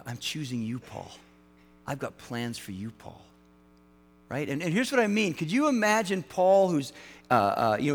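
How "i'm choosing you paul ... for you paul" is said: soft, gentle and a little lower; tender voice of Jesus. Retold or imitated. Jesus calling Paul, softly and tenderly. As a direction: speak it gently, as a quiet call.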